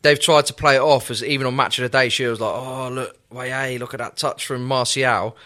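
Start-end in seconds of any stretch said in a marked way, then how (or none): 2.5-5.4 soft, low Geordie impression; half-voiced Geordie accent. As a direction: quietly mimic a Geordie pundit. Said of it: "That was Jordan".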